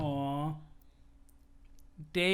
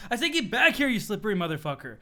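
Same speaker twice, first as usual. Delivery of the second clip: ordinary delivery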